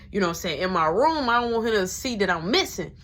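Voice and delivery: normal talking voice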